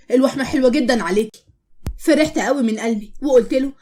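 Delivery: plain reading voice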